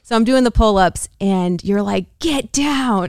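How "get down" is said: high-pitched mock yell; imitated yell. Wife imitating husband yelling mid-workout, mock yell. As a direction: order them down in an imitated high shout. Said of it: "He's screaming at me".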